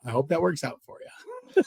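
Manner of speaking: quiet, dry, knowing sarcasm. Retold or imitated. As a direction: wish him luck without meaning it. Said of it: "I'm like".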